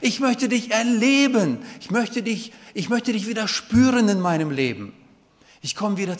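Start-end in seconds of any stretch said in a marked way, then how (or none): 0.0-4.9 loud, high pleading cry to God; David crying out to God, loud and pleading. Acted. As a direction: plead aloud like a man at the end of his rope. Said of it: "zu Gott geschrien und sagt".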